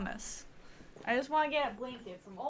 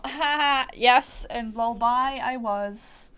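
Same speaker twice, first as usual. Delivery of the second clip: loud laughing, then a slow proud "lol bye I was"; 10 dB louder, slower than usual. Real, not acted. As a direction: laugh out loud at your own joke.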